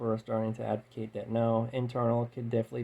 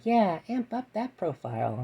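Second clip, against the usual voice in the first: higher, slow whispery woman's voice; female voice; 8 semitones higher than usual. Retold or imitated. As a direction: drawl it like a teasing inner voice. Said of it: "the female voice inside my head was like".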